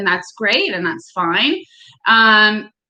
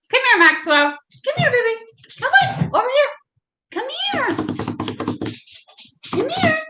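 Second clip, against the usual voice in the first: very high sing-song calling a pet; 13 semitones higher, faster than usual. Real, not acted. Calling her dog over.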